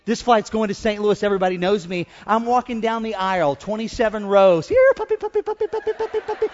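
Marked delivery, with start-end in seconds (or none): 4.7-6.5 tiny high falsetto, chanting quickly; squeaky-high dog call; puppy-calling voice. Retold or imitated. Pastor calls his runaway dog down the plane aisle, high falsetto puppy call.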